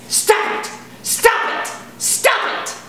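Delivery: mock stern scold, repeated; hammed-up angry father hushing a baby; imitating harsh dad. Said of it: "The dad spends the whole flight doing this".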